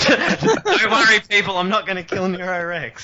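high-pitched, laughing through the words; real laughter lifting the voice high; laughing reassurance. Real, not acted. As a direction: reassure the listeners while cracking up. Joking reassurance after the cross remark.

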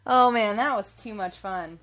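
ordinary talk, nothing marked

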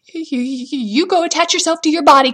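stutter, then blurt the order loudly; rattled but bossy; stammered command. A narrator's reading. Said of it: "commanded Jack".

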